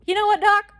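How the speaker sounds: high, loud, upbeat call to the doctor; mock-breezy address. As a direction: pitch it up high, announcing a decision. Retold decisive reply to doctor.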